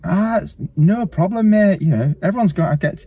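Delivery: a cheery Scarborough local forgiving a drop-in; friendly Scarborough accent. Retold or imitated. Surfer imitating Scarborough local forgiving his drop-in, friendly Scarborough accent. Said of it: "in his really friendly Scarborough accent".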